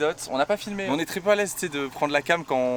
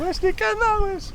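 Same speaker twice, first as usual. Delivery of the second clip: calling to ducks; much slower than usual. Real, not acted.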